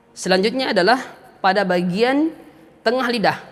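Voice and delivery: usual speaking voice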